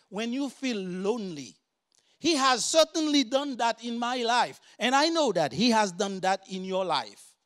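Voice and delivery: lifted pulpit warning; preaching. Acted. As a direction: warn the congregation earnestly. Preaching about the enemy.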